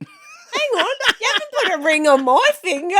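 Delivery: high, loud mock indignation; mock outrage. Wife teasing her husband about the ring, loud and high. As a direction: play up the offended partner.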